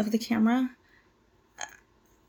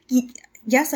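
usual speaking voice

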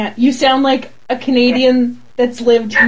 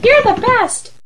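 gushing; 9 semitones higher, slower than usual. Pretend. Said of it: "I wanna be like".

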